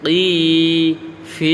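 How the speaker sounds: 'কি' and 'ফি' stretched long and rising; drawn-out syllables. Shown as a demonstration. Teacher shows what she avoids, 'কি' and 'ফি' drawn out. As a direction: drag out the vowel of 'কি' and 'ফি'. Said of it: "এরকম করে টান দিচ্ছি না".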